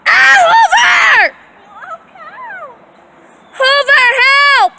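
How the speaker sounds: high, loud, hammed-up cry for help; mock distress scream calling the dog. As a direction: pretend to be in danger to test the dog. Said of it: "Scream, yeah".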